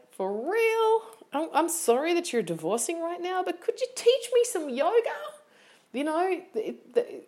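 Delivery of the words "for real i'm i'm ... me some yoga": high, disbelieving mock plea; a pitched-up exasperated student; sarcastic high voice, pleading to just be taught; incredulous student.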